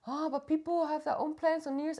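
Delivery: high, put-on complaining voice; high mock protest; mock objecting partner. Imitates partner objecting to the plan.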